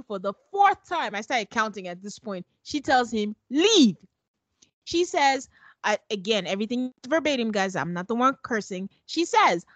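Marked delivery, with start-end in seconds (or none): 3.5-4.0 one louder, falling word; curt leave. Retold or imitated. Recapper mimics Michaela ordering Zach out, louder. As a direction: order him out in one beat.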